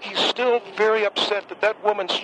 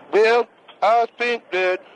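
slow, drawn-out put-on voice of the judge; impression of Clarence Thomas; 2 semitones higher, 3 dB louder, slower than usual. Caller doing a slow mock judge voice for the host. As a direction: slows right down into a mock Justice Thomas. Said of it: "I'm gonna do an impression now".

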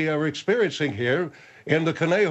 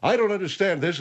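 ordinary delivery

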